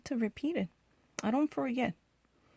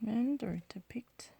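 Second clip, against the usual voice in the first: whisper; 8 dB quieter, much slower than usual. Real, not acted. Reads the game words under breath.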